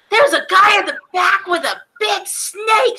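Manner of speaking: excited shout. Retold or imitated. Kid shouting news to mom, excited. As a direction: blurt it out like a thrilled kid. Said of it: "My mom's like".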